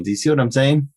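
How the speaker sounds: ordinary delivery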